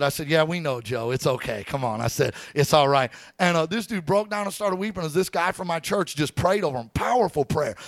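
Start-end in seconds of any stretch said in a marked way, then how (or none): 0.3-3.1 soothing. Retold or imitated. Calming a drunk, distraught man.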